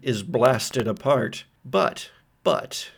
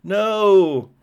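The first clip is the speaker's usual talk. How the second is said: loud, high cry of "No!"; shout; 8 semitones higher, 7 dB louder than usual. Acted. Kane cries out at the entity, loud and high. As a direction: scream it in despair. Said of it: "Kane shouts".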